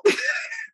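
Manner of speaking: high squeal of a laugh that cracks. Real, not acted. To listeners about the failed venture, a high squealing laugh.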